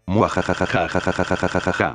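long villain cackle; evil laugh. Acted. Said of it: "¿A qué viene esa risa malvada?".

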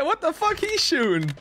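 high, mock imitation of the enemy; mocking enemy quote. Streamer mimicking what an enemy said, mock high voice. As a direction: parrot the enemy's line in a silly high voice. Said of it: "He said".

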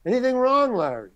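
high-pitched needling call, dropping on the name; director's mock yell. Director getting off on actor's trouble, mild mock yell. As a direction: imitate a director calling across the set. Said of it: "then the director yells".

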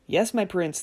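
ordinary delivery, nothing marked

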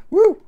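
excited high cheer; loud high woo. Real, not acted. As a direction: whoop loudly with joy. Cheer for subs.

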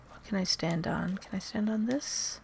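whispering. Real, not acted. Whispering while sneaking.

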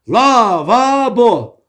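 Lavabo stretched out vowel by vowel; teacher drilling Lavabo in long, slow syllables; syllable-by-syllable mnemonic. Shown as a demonstration. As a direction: draw out each syllable of Lavabo slowly. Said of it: "A, A, O kuralı".

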